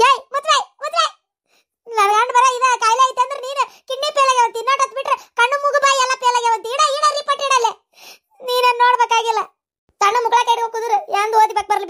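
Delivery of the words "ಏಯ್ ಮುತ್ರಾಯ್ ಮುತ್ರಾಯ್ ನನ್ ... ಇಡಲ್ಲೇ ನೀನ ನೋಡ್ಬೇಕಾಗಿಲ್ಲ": high, fast comic scolding with a laugh; mock scolding. Woman scolds her friend for reading out every disease, high and fast.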